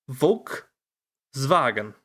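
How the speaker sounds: "Volkswagen" very slowly, split as "Volk... zvagen"; slow teaching demo of "Volkswagen", V for the W; syllable by syllable. Shown as a demonstration. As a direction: break "Volkswagen" in two with a long pause. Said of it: "Say Volk".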